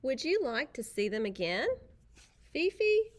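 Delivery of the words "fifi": Fifi sung out high and bright; dainty puppy name. Acted. Introducing prissy poodle puppies.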